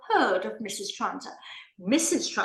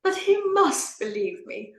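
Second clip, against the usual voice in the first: desperate plea; 7 semitones higher than usual. Acted. Lady pleading with Miss Moon, desperate. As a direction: beg to be believed.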